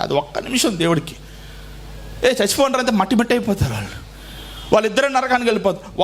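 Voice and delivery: ordinary delivery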